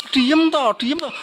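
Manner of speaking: high-pitched, exasperated hushing; mock-scold a crying child; acted parent's impatient telling-off; scolding mother. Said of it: "sudah anaknya nangis malah digebleki".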